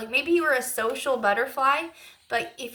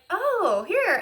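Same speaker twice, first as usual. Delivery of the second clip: put-on higher, a light little boy's offer; 8 semitones higher, 4 dB louder than usual. The boy handing over the flower, higher boyish voice. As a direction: chirp it up like a small kid. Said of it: "he was like".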